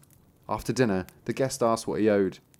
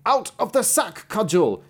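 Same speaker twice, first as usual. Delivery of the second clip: very high, slow magic command; calling a command; 10 semitones higher, 4 dB louder, slower than usual. A narrator's reading. Master teaching the cudgel's command, calling out. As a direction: call it out high, drawing out the words.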